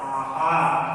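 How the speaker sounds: आहार slowly, both "aa" vowels held long; long first vowel. Shown as a demonstration. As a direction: draw out आहार as "aaa-haar". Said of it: "क्या होता है?".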